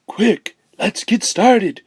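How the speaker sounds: ordinary delivery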